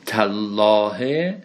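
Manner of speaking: تالله stretched very slowly, doubled l held; deliberate Arabic recitation. Shown as a demonstration. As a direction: draw out تالله like a Quran reading. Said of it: "این واو، واو حرف جر نیست".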